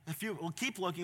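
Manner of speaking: usual speaking voice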